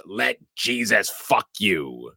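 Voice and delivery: mild mock demon yell, drawn out; demonic screaming. Recapping a horror film's possession scene, lightly imitated demonic scream. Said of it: "screaming at the same time in a demonic voice".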